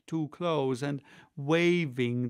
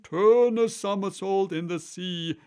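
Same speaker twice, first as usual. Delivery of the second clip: high, loud, exuberant call; wild excited cry; 6 semitones higher, 7 dB louder than usual. A narrator's reading. Mock Turtle capering through the dance steps, loud excited cry. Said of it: "cried the Mock Turtle, capering wildly".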